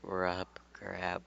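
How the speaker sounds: ordinary delivery, nothing marked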